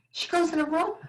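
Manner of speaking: ordinary talk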